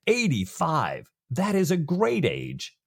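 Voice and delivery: high, drawn-out astonished exclamation; acted amazement, slow and high; impressed surprise. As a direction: marvel slowly at the number, pitched well up. Jack acted reacting to mother's age.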